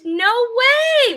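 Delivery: high, bright no way at the news; surprised delight. Real, not acted. Host hearing a viewer is from Scotland, high surprised delight. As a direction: jump up in pitch with real surprise.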